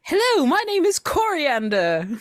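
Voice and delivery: very high, loud character voice; Coriander. Acted. Player shows off a character impression, high put-on voice. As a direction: pitch it way up for a character introduction. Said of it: "How was that?".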